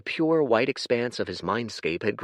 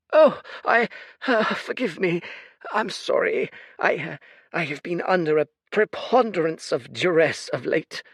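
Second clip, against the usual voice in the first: put-on high, sorrowful old man voice, apologizing; an old oracle near tears; flustered old seer; 9 semitones higher, 4 dB louder than usual. Fate's flustered voice.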